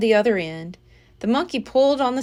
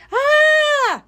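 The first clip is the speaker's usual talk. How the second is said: high, loud frightened scream; a sudden startled yell of fright; boy's shocked scream; 6 dB louder than usual. A narrator's reading. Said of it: "Jack screamed".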